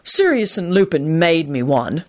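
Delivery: slow, pompous boast; self-important. Acted. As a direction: puff yourself up. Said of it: "responded self-importantly".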